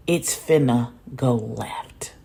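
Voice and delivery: slow ominous whisper. Real, not acted. Warning viewers the party will turn bad, slow whisper. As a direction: breathe the warning slowly.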